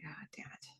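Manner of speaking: under-the-breath whisper; whispered curse. Retold or imitated. Tom after his sigh at the diner, whispered curse. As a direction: mutter it barely aloud, whispered.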